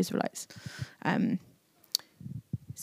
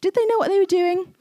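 high, disbelieving squeak of a question; high-pitched mock disbelief; incredulous; 11 semitones higher, 3 dB louder than usual. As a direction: ask it like you can't believe they did it. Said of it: "you're like".

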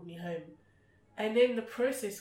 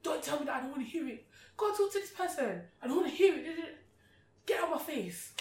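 high, loud telling-off of dad; arguing with sick dad, mock heated; heated argument; 7 semitones higher, 5 dB louder, faster than usual. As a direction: scold him like you mean it. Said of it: "I'd be like".

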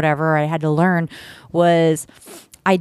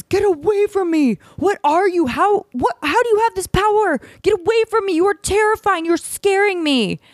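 high, frightened squeal of a startled rabbit; squeaky terrified rabbit voice; 13 semitones higher than usual. Acted. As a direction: shriek it like a panicked little animal. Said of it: "Rabbit's eyes go wide and Rabbit says".

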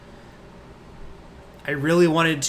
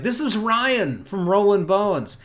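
introducing; 7 semitones higher, 4 dB louder, slower than usual. Retold or imitated. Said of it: "And he's like".

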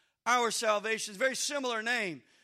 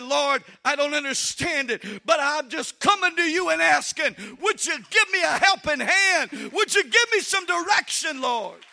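louder and higher, calling out to God; pleading prayer; 5 semitones higher, 9 dB louder than usual. Pretend. Mother crying out loudly to the Lord for direction. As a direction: cry out for help in earnest prayer.

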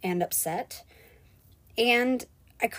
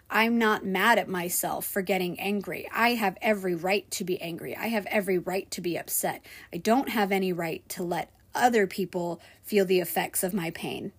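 usual speaking voice

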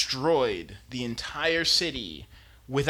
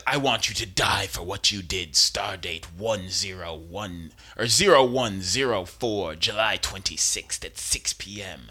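stern accuser; 6 semitones lower than usual. Retold or imitated. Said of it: "So she says".

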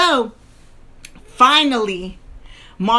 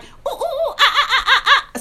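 monkey hoots; 12 semitones higher than usual. Retold or imitated. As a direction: jump in hooting like a wild monkey to rouse your husband. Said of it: "acting like a monkey".

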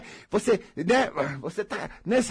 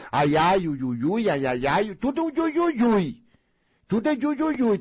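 mock whining ui ui; 4 semitones higher than usual. Mocking complaining people.

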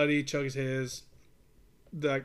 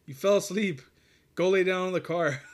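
raised, higher voice, retelling an order; retold scolding; 5 semitones higher, 6 dB louder than usual. Waking the buddy from his chili burger, retold scolding. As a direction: loudly tell off a sleepy buddy, re-enacted.

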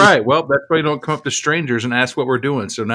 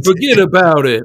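a quick jokey Brooklyn "forget about it"; tossing off a New York tough-guy line; mock New York accent; 5 semitones higher, 6 dB louder than usual. Friends joking about filming in New York, in a light mock New York accent. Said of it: "I was about to say".

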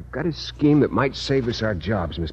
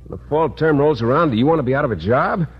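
ordinary talk

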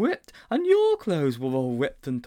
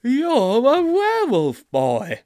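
slow, much louder, higher proclamation; dramatic reveal; 12 semitones higher, 10 dB louder, slower than usual. Acted. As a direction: announce it loud and slow for effect. Sponge's dramatic revelation.

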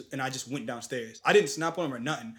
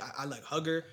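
ordinary talk

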